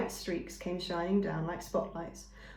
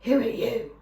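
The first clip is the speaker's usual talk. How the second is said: wary hidden creature; 3 semitones higher, 5 dB louder than usual. Acted. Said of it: "said a voice".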